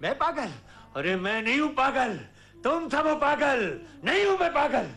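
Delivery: high-pitched frantic protest; indignant denial. Acted. Father insisting he is not mad, frantic indignation. Denies madness to family.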